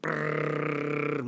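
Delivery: long buzzing lip trill; chewing noise. Acted. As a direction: imitate rapid gnawing with a sustained brrr. Imitates Rabbit chewing the stick.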